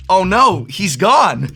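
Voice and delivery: loud, high cry of alarm; retold dismay. Retelling his dismay at the time.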